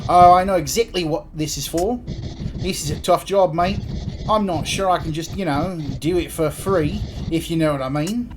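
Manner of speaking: Antipodean-Cockney mate voice, haggling; postman Creed, cagey. Acted. Creed reluctant to do the job for free, Kiwi-ish Cockney. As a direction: hedge like a chummy Kiwi-sounding postman.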